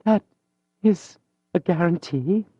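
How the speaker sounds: a proud lady suddenly meek; humble young woman. Acted. Mathilde to Julien, pale and humbly pleading.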